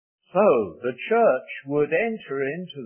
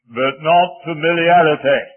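loud, forceful imitation of an old preacher; flashing emphatic warning; 3 semitones higher, 9 dB louder than usual. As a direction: boom out the caution as the old evangelist. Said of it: "his eyes flashed, and he said".

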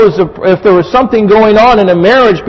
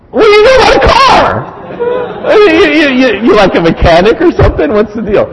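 much higher, incredulous mock whine; mocking scoff; 9 semitones higher than usual. Pastor mimics himself scoffing at his wife, high incredulous mockery. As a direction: sneer at her car knowledge, half-laughing.

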